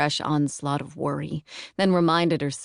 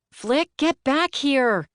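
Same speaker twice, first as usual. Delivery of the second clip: yelling; 9 semitones higher, slower than usual. A narrator's reading. Aunt yelling after the boy fleeing toward the bog. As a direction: shout after a running boy. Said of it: "Caroline yelled".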